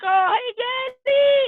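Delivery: high, drawn-out sing-song greeting; loud, high-pitched mock child voice; excited little kids. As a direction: imitate kids squealing hello to their dad. Acts out his kids greeting him.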